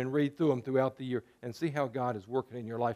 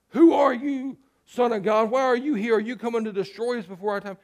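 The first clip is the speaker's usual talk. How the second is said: much higher, loud, frantic demon voices; strange demon voices; 7 semitones higher, 5 dB louder than usual. Acted. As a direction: shriek like demons caught off guard. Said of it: "these strange voices coming out of this guy".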